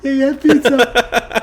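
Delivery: high, loud burst of laughter through the words; laughing. Real, not acted. Man joking with interviewer about pizza, laughing. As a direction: crow it out while laughing.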